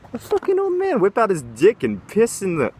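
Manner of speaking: high, loud disgusted outburst; outraged rant. Real, not acted. Ranting at the old man.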